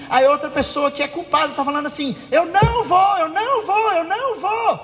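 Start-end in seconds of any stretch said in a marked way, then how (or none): 2.3-4.8 higher-pitched stubborn refusal, over and over; insistent refusal. Acted. Guilty person refuses to go buy bread, insistent. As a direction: dig in and talk over the other. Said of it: "fica insistindo e aumentando".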